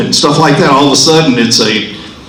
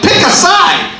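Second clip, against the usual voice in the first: shouted command; 6 dB louder than usual. Retold or imitated. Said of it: "and I'm like".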